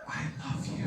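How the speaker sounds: whispered love declaration; soft whispered confession. Retold or imitated. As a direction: breathe it as a tender whisper.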